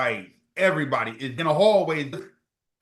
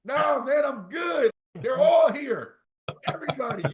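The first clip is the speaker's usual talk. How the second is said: excited reassurance; 11 semitones higher than usual. Retold or imitated. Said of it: "And I'm like".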